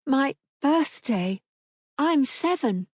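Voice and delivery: put-on high, slow child voice; little girl. A narrator's reading. Daughter telling Papa her age, slow and high. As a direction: say it proudly small.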